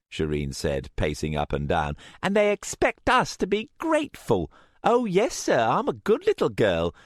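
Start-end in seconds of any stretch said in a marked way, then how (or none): 2.2-6.9 bitter mockery. A narrator's reading. Shireen mocking the men.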